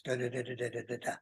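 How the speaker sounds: low, soft filler syllables imitating a friend's patter; glossed-over joke. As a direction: skim through someone else's joke under your voice. Bandmate's joke skipped with filler syllables.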